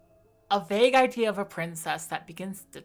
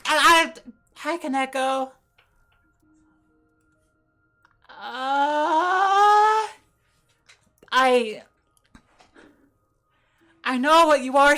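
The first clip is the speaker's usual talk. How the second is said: shaken, stammering and breathless; scared stammer; 5 semitones higher, 8 dB louder, slower than usual. Real, not acted. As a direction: stammer it out, still rattled. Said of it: "but that was terrifying".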